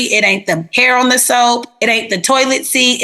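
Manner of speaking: usual speaking voice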